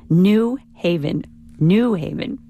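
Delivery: NEW Haven, drawn out; stress on NEW. Retold or imitated. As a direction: slowly mimic "New Haven", leaning on New. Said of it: "bugs me to hear your correspondent say".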